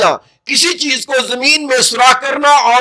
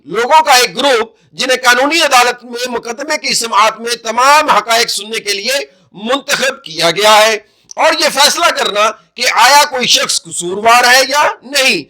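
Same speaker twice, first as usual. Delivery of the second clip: usual speaking voice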